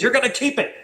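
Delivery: a lightly voiced sponsor's sly prophecy; Dennis predicting. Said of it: "He says".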